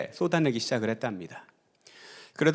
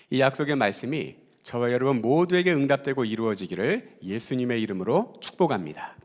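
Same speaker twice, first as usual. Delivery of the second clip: ordinary talk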